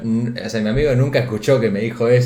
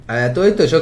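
normal talking voice

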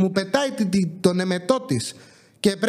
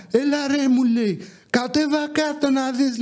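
higher, slow mock demand; mock pushy driver; 6 semitones higher than usual. Driver calling the mover downstairs to see the damage, mock pushy and higher. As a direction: ham up the driver's whiny demand.

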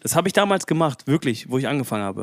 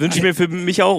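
higher and louder, joking it for himself; a louder, playful tease aimed at himself; playful excited; 5 semitones higher, 6 dB louder than usual. Real, not acted. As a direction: turn the wish back on yourself with a grin.

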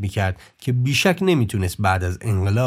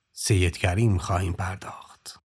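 whispered close; 4 semitones lower, 4 dB quieter than usual. Acted. Closing line of intro, whispered.

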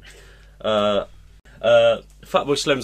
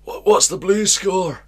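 higher, louder, slow [name] bellow at Sharon; [name] calling Sharon; 10 semitones higher, 7 dB louder, slower than usual. Retold or imitated. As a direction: drawl it out like [name] asking his wife. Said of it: "He's more".

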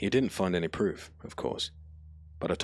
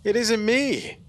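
high, loud desperate plea; pleading; 9 dB louder, slower than usual. Acted. Frightened boy pleading with the crow, high and loud. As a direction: beg as if your life depends on it. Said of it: "Ataro pleaded".

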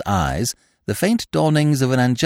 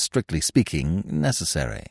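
plain reading voice

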